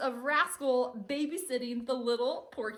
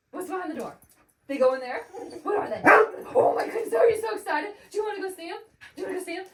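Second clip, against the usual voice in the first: excited pet talk; 8 semitones higher, 9 dB louder, much faster than usual. Real, not acted. Owner talks to dog again.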